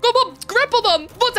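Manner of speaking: high, loud frantic yell; desperate high yell. Real, not acted. Player shouting at the game in a chase, frantic high yell. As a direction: shout it up high, begging the grapple to work.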